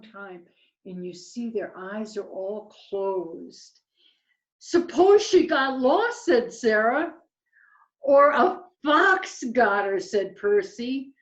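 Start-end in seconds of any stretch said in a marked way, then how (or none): 4.6-6.3 high, loud little owl voice; raised, projected owlet worry; worried owlet. Acted. Voicing worried Sarah owlet. 8.0-10.0 higher, louder, fretful little owl; raised voice of an anxious owlet; worried owlet. Acted. Voicing worried Percy owlet.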